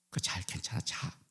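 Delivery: put-on hushed whisper to a child falling asleep; a mother whispering her son to sleep, hushed whisper; whispered lullaby.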